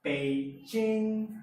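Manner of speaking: Beijing as "pei-ching", high and loud; Mandarin pronunciation. Shown as a demonstration. Teacher reading out the Pinyin example, Mandarin pronunciation. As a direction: stretch the end of Beijing on a high level tone. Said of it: "it's written as".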